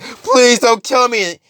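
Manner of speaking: high, drawn-out begging; pleading. Acted. Musician begging Carnage for his life, pleading. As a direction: beg in acted terror.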